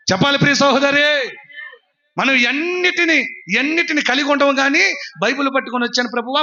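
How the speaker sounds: high, stretched preacher's delivery; full-force preaching. Acted. Preacher challenging believers who come carrying Bibles, full-force preaching.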